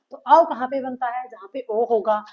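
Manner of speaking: normal talking voice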